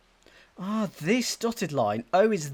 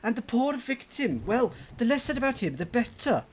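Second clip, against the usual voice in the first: higher-pitched, amateur put-on Welsh accent; attempted accent; 7 semitones higher than usual. Player reads out the victim's grisly fate, put-on Welsh accent. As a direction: voice a customer in a shaky attempt at Welsh. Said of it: "I can't do accents".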